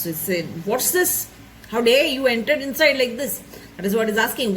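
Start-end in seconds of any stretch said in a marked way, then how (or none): none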